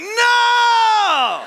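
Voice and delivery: put-on a high, loud child's yelp of "No!"; high, loud, panicked child's no; shouted refusal. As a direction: shout it out like a kid refusing his food. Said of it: "He's like".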